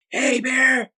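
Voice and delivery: high-pitched shout to stop a bear; urgent, high holler, acted out; retold scream. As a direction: re-enact yelling at a charging animal. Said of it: "And I screamed it".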